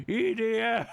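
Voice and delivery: shouted "E D M"; yell. Retold or imitated. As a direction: yell it out at a bug. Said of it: "I have to yell".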